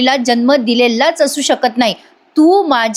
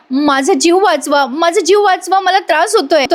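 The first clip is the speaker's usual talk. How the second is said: desperate plea; 6 semitones higher than usual. Retold or imitated. Priya trapped in the fire begs for her life, higher mock plea. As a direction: plead as if the fire burns. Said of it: "Priya म्हणत असते".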